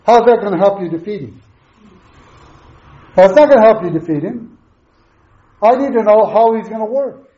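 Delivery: usual speaking voice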